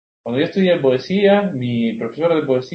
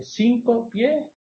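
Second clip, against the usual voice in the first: drawn out explanation; 5 semitones higher, much slower than usual. Shown as a demonstration. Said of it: "¿A qué hace referencia?".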